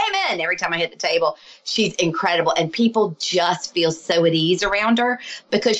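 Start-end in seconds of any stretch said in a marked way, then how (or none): 0.1-0.4 high, sudden imitated blurt; imitated Tourette's tic. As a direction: burst it out high, like a verbal tic. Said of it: "she's, like, blurting stuff".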